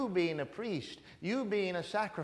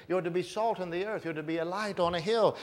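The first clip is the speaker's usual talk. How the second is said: usual speaking voice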